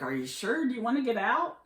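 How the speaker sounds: ordinary talk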